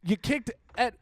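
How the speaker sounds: high, loud mock outrage; mock scolding. Host ordering a friend off the show, mock outrage. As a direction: throw them out in pretend anger.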